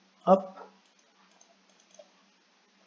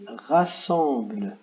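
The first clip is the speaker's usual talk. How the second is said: lecture de consigne lente; much slower than usual. Shown as a demonstration. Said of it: "Il y a marqué".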